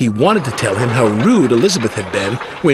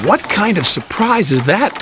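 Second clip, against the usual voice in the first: higher, puzzled question; wondering; 8 semitones higher than usual. A narrator's reading. Thomas asks about the promised surprise, wondering. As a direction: wonder aloud with lifted pitch. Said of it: "Thomas wondered".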